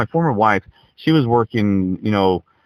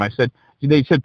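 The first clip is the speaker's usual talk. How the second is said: ordinary talk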